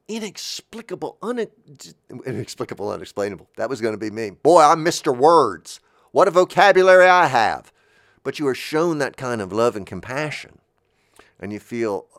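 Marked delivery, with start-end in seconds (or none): 4.3-7.7 self-mocking boast. Host mocking his own fumbled words, loud mock pride. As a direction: boom it out, making fun of yourself.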